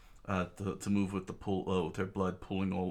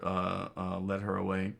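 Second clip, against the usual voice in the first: ordinary delivery, nothing marked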